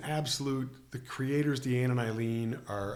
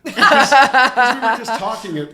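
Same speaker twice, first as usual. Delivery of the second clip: laughing, higher and loud; 12 semitones higher, 18 dB louder, slower than usual. Real, not acted. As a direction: talk through a real laugh.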